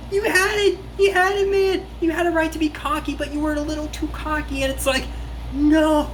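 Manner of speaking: high-pitched fan despair; exasperated lament. Real, not acted. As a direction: cry out at the screen in dismay. Lamenting the character's mistake.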